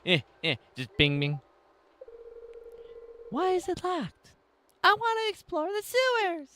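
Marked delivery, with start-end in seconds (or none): 3.3-6.5 put-on high kid voice. Voices kids' NPC dialogue.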